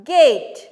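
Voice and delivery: Gate said loud with a punched hard g; teacher stresses the hard g in Gate; hard g stressed. Shown as a demonstration. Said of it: "നമ്മൾ stress കൊടുക്കണ".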